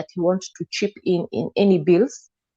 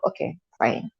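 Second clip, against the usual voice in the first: softer, worn-out agreement; a tired, softer okay to end the fight; resigned okay; 8 dB quieter than usual. Retold or imitated. Giving in to his threats.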